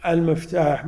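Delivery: ordinary delivery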